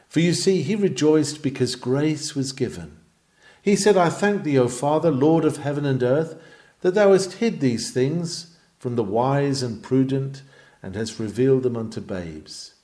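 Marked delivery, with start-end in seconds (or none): none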